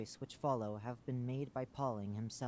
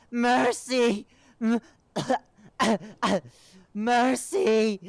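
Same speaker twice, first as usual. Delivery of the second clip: very high, loud crying scream; screaming creature; 18 dB louder, much slower than usual. Acted. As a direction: scream for mercy.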